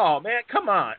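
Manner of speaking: high mock complaint; pleading Virgil imitation. Imitates Virgil pleading to keep table.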